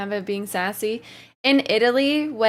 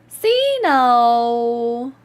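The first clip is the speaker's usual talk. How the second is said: gasp, then a loud rising call of Zeno; thrilled to see a friend arrive; delighted gasp and name called out loud; excited greeting; 7 dB louder than usual. Real, not acted.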